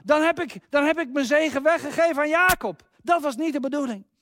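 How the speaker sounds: put-on high, loud cry of dismay from an old father; Isaac crying out on realizing he blessed the wrong son. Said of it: "hoort hij zijn vader roepen".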